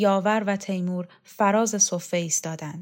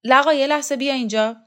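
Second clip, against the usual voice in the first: higher, louder, quick call across the yard; shouting; 7 semitones higher, 8 dB louder, faster than usual. Acted. Photographer shouting to a girl across the steps, loud quick call. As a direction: call her over at full voice. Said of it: "داد زد".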